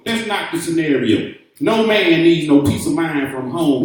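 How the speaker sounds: ordinary talk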